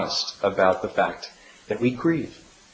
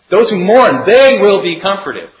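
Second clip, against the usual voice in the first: preaching at full force; 7 semitones higher, 8 dB louder than usual. Acted. Preaching climax.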